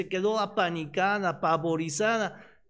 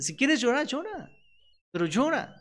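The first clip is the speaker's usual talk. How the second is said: insistent mother; 8 semitones higher than usual. Retold or imitated. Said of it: "se me dice".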